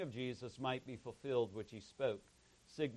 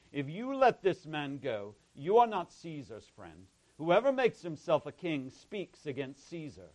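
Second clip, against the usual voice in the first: mildly raised, insistent reading; crowd's outcry; 3 semitones higher, 7 dB louder than usual. A narrator's reading. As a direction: lift the voice a little in protest.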